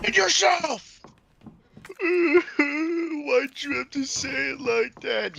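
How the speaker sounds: high laughing yell, stretched out; laughing shout. Real, not acted. Friend shouting at the game while laughing. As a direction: shriek it through laughter.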